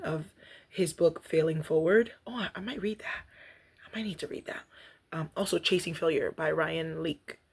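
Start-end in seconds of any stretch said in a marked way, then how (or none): 2.3-4.6 whispered aside. Real, not acted. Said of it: "aside to self".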